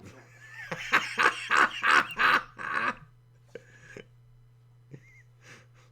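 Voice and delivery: loud, long cackling laugh; real uproarious laughing fit; burst of laughter. Real, not acted. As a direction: crack up helplessly, then run out of air. Dog leaps out of her arms.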